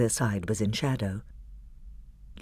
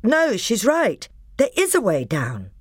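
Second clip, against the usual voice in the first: put-on a boy backing up his sister; lightly voiced boy, sure of himself; Edmund; 9 semitones higher, 4 dB louder than usual. A narrator's reading. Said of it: "Edmund's voice saying".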